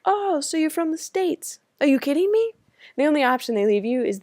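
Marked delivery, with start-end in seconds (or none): 0.1-2.5 mock incredulous. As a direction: ham up their disbelief at a foreigner. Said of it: "they'll be like".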